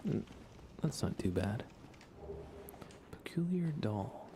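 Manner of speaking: normal talking voice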